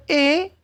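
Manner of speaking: "E" said as a Spanish e, pitched high; letter pronounced E. Shown as a demonstration. Teacher telling students how the article A sounds, "E" pronounced high. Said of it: "se pronuncia como una E".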